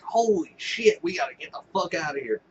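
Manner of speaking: high panicked yelp, then rushed; mock panicked. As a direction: scramble to flee in mock panic. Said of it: "They're like".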